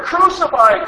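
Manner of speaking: high, loud yell rising at the end. Acted. Crowd pushing Pilate harder, high rising yell. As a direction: scream it up at him. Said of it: "kept shouting even louder".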